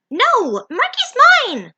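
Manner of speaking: high, loud possessive shriek. Acted. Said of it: "Zoe shrieked".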